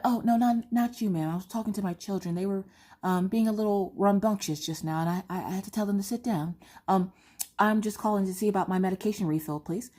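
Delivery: smooth it over courteously with the receptionist; customer service voice. Retold or imitated. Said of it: "I'm like".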